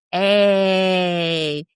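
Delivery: lightly put-on drawn-out call; mock scheming man. Voicing an ex-husband with a plan, lightly mock scheming. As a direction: imitate a man hatching a plan. Voices the man spotting his chance.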